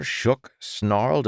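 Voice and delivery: usual speaking voice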